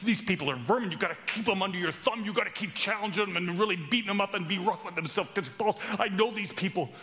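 high, urgent scolding; outraged objection. Acted. Judaizer warning Paul against teaching grace, high agitated rant. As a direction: scold and warn, starting at a shout.

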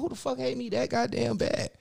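high stunned who-hates-me; baffled and stung; high incredulous cry; hurt disbelief. Retold or imitated. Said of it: "I'm like".

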